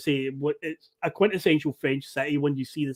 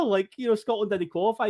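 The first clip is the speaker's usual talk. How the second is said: ordinary talk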